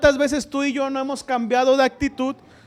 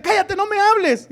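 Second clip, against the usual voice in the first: higher, louder rude snap; mock snappy child; 7 semitones higher, 4 dB louder than usual. Child suddenly snapping at a parent, mock angry. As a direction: throw a sudden angry brush-off. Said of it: "Y a la media hora decirte".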